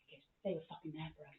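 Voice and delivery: soft, low, quick justification; imitated dad, quietly intense; low intense explanation. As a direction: say it fast under the breath. First take, dad's low threat.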